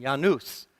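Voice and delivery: Janus said YAH-noos, with a Y sound; Latin pronunciation. Shown as a demonstration. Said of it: "I looked up the correct pronunciation".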